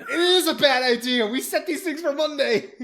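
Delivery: loud, high, exasperated protest. Real, not acted. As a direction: cry out in mock outrage. Exasperated protest about schedule.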